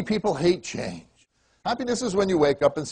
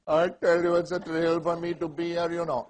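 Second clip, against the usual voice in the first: put-on Pakistani English accent; quoting himself in a Pakistani accent. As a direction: drop back into an imitated Pakistani accent. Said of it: "why'd I start that old accent".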